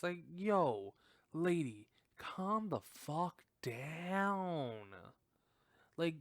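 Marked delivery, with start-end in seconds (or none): 0.3-4.8 drawn-out, exasperated telling-off; raised pitch, slowing into a drawl; mock exasperation. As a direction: stretch the last words in weary protest. Scolding a film character as if present.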